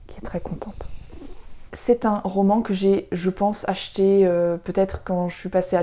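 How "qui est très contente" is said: soft whispered aside for the doll. Pretend. Passing on the doll Taria's hello to viewers, whispered.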